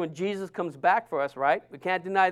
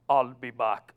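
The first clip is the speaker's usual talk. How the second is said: Terminator impression; 8 semitones lower, 6 dB quieter than usual. Shown as a demonstration. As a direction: lower voice, flat and heavy like the movie cyborg. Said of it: "You just gotta say it right".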